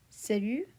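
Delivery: salut said with a silent T; French salut. Shown as a demonstration. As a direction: drop the final T in salut. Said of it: "Same in".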